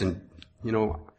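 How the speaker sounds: ordinary delivery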